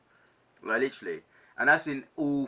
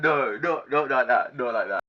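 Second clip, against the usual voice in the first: flustered denial; 3 semitones higher, 8 dB louder than usual. Retold or imitated. Telling the officer it's not like that, louder flustered denial. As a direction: rush to correct the misunderstanding. Hastily correcting a misunderstanding.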